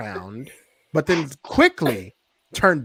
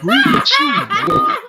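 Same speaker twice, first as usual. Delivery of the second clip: much higher, loud shouted craving; Dusty shouting; 10 dB louder than usual. Retold or imitated. Dusty demanding greasy food, impersonated shout. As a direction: belt out the punchline in a strained high shout. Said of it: "that's a great fucking impression".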